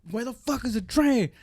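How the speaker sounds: high mock yell, softened volume; exasperated, high-pitched complaint; retold yelling. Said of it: "he keeps yelling it".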